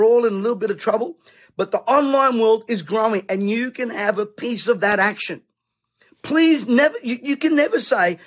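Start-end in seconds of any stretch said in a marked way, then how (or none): 6.2-8.2 urgent preacher's plea. Real, not acted. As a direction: press the point home urgently.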